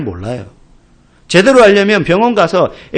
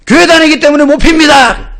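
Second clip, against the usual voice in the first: loud, higher shouted refusal, 교회 stressed; military shout; 9 semitones higher, 7 dB louder than usual. Retold or imitated. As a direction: shout it at attention to the squad leader. Recruit's loud military reply.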